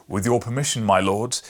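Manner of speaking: loud, eager cry to his lords; cried out. A narrator's reading. King cries to his lords, loud and eager. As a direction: call out with sudden delight. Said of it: "he cried".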